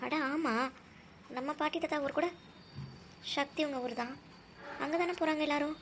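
very soft, thinking to oneself; quiet musing aside. Acted. Musing privately about joining the trip, quiet aside. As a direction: murmur a dawning idea privately.

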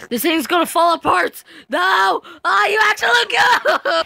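high, loud wailing at the game; screaming no at the screen; panicked shouting. Real, not acted. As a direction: cry out as it falls apart.

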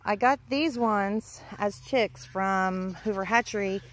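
ordinary talk

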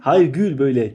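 ordinary talk, nothing marked

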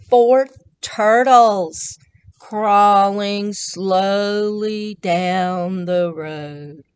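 very slow, drawn-out words. Acted. Storyteller reads the turtles' crawl to children, very slow.

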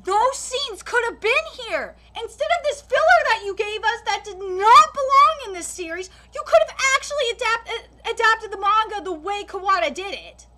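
angry rant. Real, not acted. Reviewer scolding the studio for its filler, angry. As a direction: vent your frustration at them.

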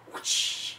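unvoiced, airy swoosh; whoosh noise. Miming spinning him around, whoosh noise. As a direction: blow out a whoosh of moving air. Imitates swinging someone around.

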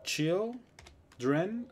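put-on Children in two slow, separated syllables; demonstrated syllable by syllable. Shown as a demonstration. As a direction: break Children slowly into two parts. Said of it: "la única manera en que lo puedo decir es así".

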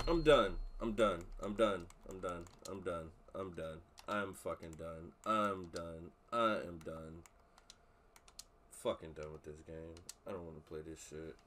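defeated. Real, not acted. Streamer gives up after loss.

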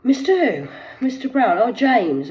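high, loud startled question; mock surprise. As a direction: pounce on the name.

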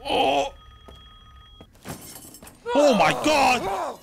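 slow, drawn-out high shout; alarmed shout. Real, not acted. Gamer yelling as the monster appears. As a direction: shout it out in shock.

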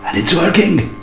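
delighted high burst of surprise; excited. Real, not acted. Repairman cheering as the old motor starts running, excited. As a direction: cheer as the motor finally spins. Motor finally runs on mains power.

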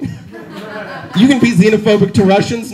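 usual speaking voice

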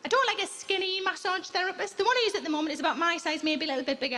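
ordinary delivery

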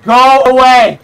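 shrieking high and loud in panic; terrified scream. Real, not acted. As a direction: scream it, really frightened. Screaming at a jump scare.

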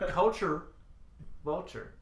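CULTURE, a long pause, then VULTURE; slow, careful. Real, not acted. Podcaster retrying a tongue twister, slow and careful. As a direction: separate "culture" and "vulture" deliberately, dropping on the second. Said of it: "I have to say that so slow".

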